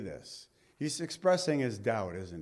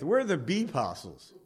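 laughing joke; 3 semitones higher than usual. Real, not acted. Joking about us.

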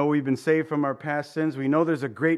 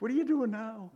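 higher, exasperated; mock exasperation; 8 semitones higher than usual. Preacher voicing his own exasperated prayer. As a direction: roll your eyes at God. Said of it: "That's my phrase".

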